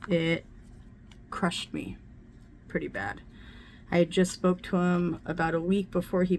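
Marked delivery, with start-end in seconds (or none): 0.1-3.2 choked-up whisper. Real, not acted. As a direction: voice dropping away to a near-whisper. Grief over murdered adopted son.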